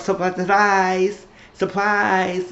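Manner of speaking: high, drawn-out announcement; hammed-up, stretched-out surprise; mock surprise reveal. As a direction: sing it out as a gleeful reveal. Acting out the big reveal.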